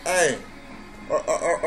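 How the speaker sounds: ordinary talk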